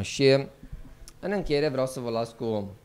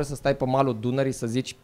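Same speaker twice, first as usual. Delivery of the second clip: normal talking voice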